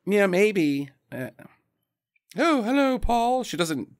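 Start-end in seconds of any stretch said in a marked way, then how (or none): 2.4-3.4 put-on high lady voice with fancy New England accent; put-on fancy New England lilt, pitched up; [name]. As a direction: greet him as a snooty, borderline British East Coaster. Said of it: "New Englanders when they try to sound fancy".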